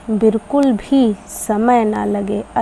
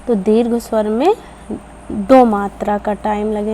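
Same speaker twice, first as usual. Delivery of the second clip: ordinary delivery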